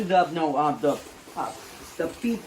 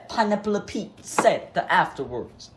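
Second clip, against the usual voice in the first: ordinary talk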